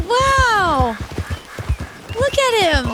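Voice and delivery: loud, high exclamation of wonder; amazed. Real, not acted. Streamer admiring the moose to viewers, amazed. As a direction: marvel out loud at the sight.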